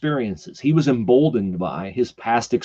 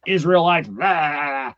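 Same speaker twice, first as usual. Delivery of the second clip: drawn-out, higher mock holler; mock yelling; 5 semitones higher, much slower than usual. Goliath jeering across the valley, mock yell. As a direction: stretch the insult as if calling far off. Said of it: "he hears Goliath yelling across the valley".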